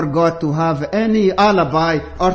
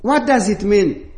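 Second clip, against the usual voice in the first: usual speaking voice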